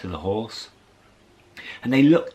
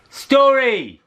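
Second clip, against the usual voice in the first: high, loud call of Story! with a falling end; 13 semitones higher, 6 dB louder than usual. Shown as a demonstration. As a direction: shout "Story!" to the audience. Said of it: "Not bad".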